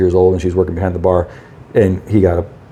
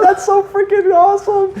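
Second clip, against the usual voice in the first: delighted lady. Retold or imitated. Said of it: "She's like".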